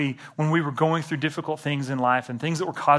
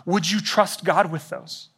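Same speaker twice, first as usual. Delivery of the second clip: ordinary delivery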